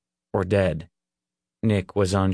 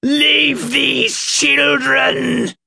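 slow, high, furious scream; wrathful shriek; 6 dB louder, much slower than usual. A narrator's reading. Reverend orders the demon out, shrieking in wrath. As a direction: scream the order, drawing out each word. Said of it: "his voice filled with wrath".

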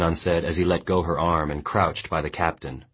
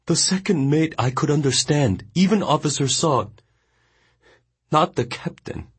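weeping; 12 semitones higher, 3 dB louder than usual. A narrator's reading. The boy weeping in Mongnan's arms. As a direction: choke it out through tears. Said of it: "He was weeping uncontrollably".

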